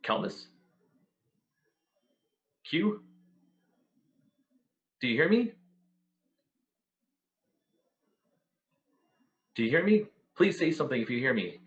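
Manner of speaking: impatient voice calling through a wall; muffled voice. Acted. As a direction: call out impatiently, muffled and distant. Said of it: "a muffled voice, kind of, uh, speaking rather impatiently".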